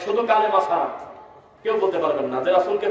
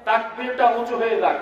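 ordinary delivery

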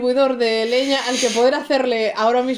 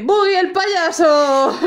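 slow, higher "Boogie el payaso" drawn out like an announcer; announcer style; 6 semitones higher, 4 dB louder, much slower than usual. Acted. Dramatic announcement of the clown.

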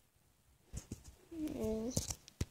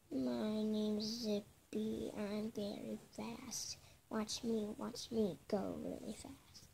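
toy voice; 6 semitones lower, 9 dB quieter, slower than usual. Pretend. Child voicing fast toy.

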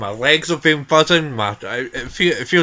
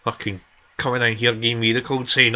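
ordinary talk, nothing marked

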